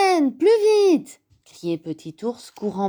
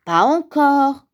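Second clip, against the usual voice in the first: louder, higher, slow grumble; grumbling; 7 semitones higher, 6 dB louder than usual. A narrator's reading. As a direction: grumble it out, mildly fed up. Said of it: "grommela Grand Ours". (grommeler, un peu agacé)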